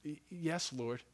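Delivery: hushed, hesitant answer; a shamed disciple barely getting it out; timid reply. Acted. Peter answers Jesus, ashamed.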